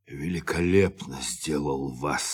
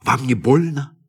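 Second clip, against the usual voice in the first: concerned question; 10 semitones higher, 8 dB louder than usual. Acted. Worried question over dead man.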